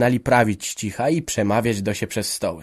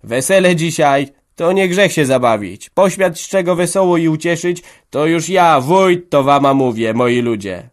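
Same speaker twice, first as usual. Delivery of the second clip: loud, higher solemn proclamation; serious tipsy speech; 6 semitones higher, 7 dB louder than usual. A narrator's reading. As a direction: declare it like a village elder. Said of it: "dorzucił poważnie Ambroży". (głośna, wyższa, poważna przemowa Ambrożego)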